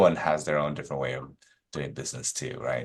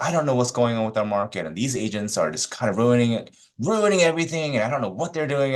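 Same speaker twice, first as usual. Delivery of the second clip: raised, climbing mock rant; imitated yelling rant; 7 semitones higher, 3 dB louder than usual. Losing agent ranting about the market, mock yelling. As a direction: imitate a worked-up agent, higher as it goes. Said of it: "Why are you yelling at me?".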